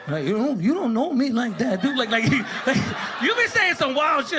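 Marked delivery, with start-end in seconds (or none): 0.2-2.0 high, hammed-up bravado; mock tough. Punked kid talking to himself, mock tough. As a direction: act tough and fail at it. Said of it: "trying to make yourself feel more tough".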